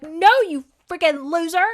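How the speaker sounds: high, loud, indignant put-down; mock scornful rejection. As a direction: fling it at an unwanted suitor. Retold girl rejects her suitor.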